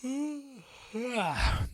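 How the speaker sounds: a yawn dragging out. Real, not acted. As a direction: let a yawn take over. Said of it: "there's the yawn".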